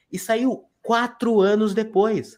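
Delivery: QUATRO ANOS DEPOIS drawn out high and loud; incredulous emphasis. Real, not acted. Mocking the film's long delay.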